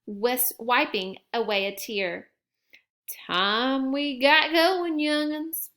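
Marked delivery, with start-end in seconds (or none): 3.3-5.7 slow folksy grandpa voice; Gramps' voice. A narrator's reading. Grandpa to the cubs about the time, slow and folksy. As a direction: drawl it out slowly like an old grandpa. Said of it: "said Gramps".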